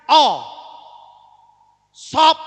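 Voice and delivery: "O" and "Sop" loud, short, open O. Shown as a demonstration. Teacher demonstrating the vowel with an example, loud and emphatic. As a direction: punch out the O in "Sop". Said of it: "Jadi bunyinya".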